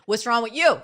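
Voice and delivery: high, loud, fiery comeback; hot-tempered New Jersey Italian shout. Retold or imitated. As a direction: spit it back with fire. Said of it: "in me, fiery".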